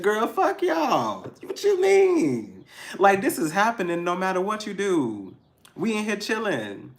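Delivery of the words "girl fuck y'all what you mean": high, slow, sassy brush-off; dismissive girl attitude. Retold or imitated. Speaker voicing the energy of brushing everyone off, high and sassy. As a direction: throw it away with high sass. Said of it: "we in the energy of".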